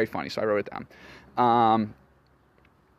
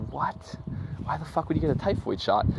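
mildly imitated incredulous mom, pitched up; retold disbelief in a higher voice; 6 semitones higher than usual. Mom questioning the typhoid shot, mock incredulous.

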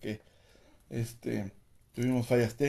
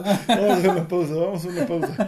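laughing; 4 semitones higher, 5 dB louder than usual. Real, not acted. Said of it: "¿Por qué no puedo?".

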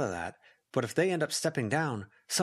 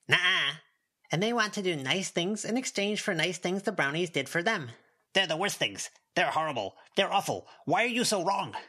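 higher, indignant yappy creature; indignant little goblin; 5 semitones higher than usual. A narrator's reading. Goblin arguing with Snowdrop about brownies, higher indignant voice. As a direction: protest it like a petulant goblin.

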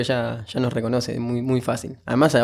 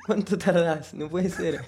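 laughing; 5 semitones higher, 6 dB quieter, slower than usual. Real, not acted. Laughing at the joke.

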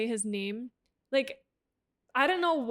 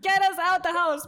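higher and louder, hammed-up demand; louder mock plea; 6 semitones higher, 6 dB louder than usual. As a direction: say it again as a big mock outcry. Repeated plea, louder take.

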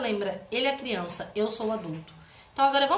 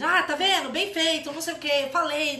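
higher, mock told-you-so; gloating; 4 semitones higher, 4 dB louder than usual. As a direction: rub it in like a smug parent. Pai zombando da criança culpada. (imitação de "bem feito", zombeteira)

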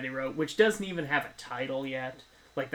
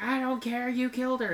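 mock Annie; 9 semitones higher than usual. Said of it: "she's like".

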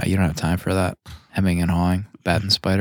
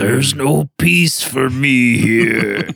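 higher, very drawn-out dramatic impression; cartoon quote voice; 5 semitones higher, much slower than usual. Podcaster quoting a classic cartoon episode, slow dramatic character voice. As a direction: stretch it out like a tormented cartoon hero.

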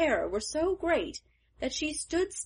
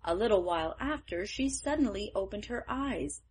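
ordinary delivery, nothing marked

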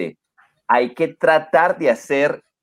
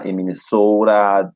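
slow, drawn-out Minnesota vowels; Minnesota accent; 4 semitones lower, slower than usual. Retold or imitated. Imitating Minnesotans to a friend, slow drawn Minnesota accent. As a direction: stretch the last part like a Minnesotan. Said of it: "they do have this kind of accent".

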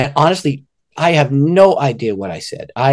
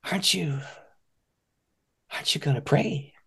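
soft whispered question; hushed plea; 3 semitones higher, 12 dB quieter than usual. Retold or imitated. The sick man asking him softly to pray. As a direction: ask quietly, almost whispering. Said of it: "And he said, "Wait".

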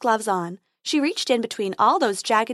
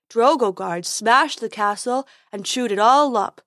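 loud, heavy troll voice landing on all; troll Fred; 6 dB louder than usual. Acted. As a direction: drop hard on all like a dim troll.

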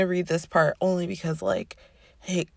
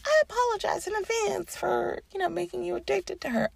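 mock apology; 13 semitones higher, faster than usual. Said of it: "I was gonna be like".

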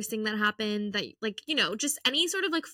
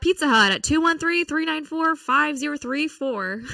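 loud, higher, hammed-up jingle read; radio ad voice; 4 semitones higher, 8 dB louder than usual. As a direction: sell it like a pizza ad. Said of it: "That was good".

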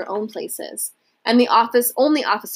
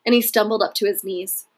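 plain reading voice

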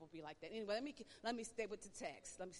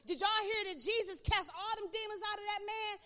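loud, high gossipy call; spreading the hot news; excited gossip; 8 semitones higher, 9 dB louder than usual. Retold or imitated. Townsfolk telling each other the news, loud and excited.

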